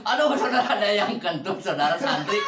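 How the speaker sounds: laughing while speaking; breaking up with laughter. Real, not acted. Someone farted, laughing.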